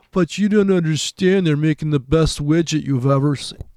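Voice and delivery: high, loud, put-on voice of a naive investor; mock investor protest. Trader mocking an investor who defends fundamentals, high and whiny. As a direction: whine it like a believer defending the company.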